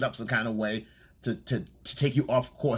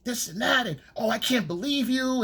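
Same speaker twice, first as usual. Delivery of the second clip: high, indignant mock outrage; mock fake anger; 11 semitones higher than usual. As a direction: play offended, pitched way up. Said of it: "this fake anger".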